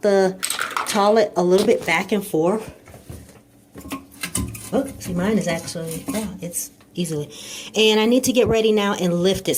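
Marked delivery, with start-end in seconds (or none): none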